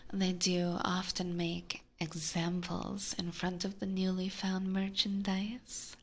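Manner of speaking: ordinary talk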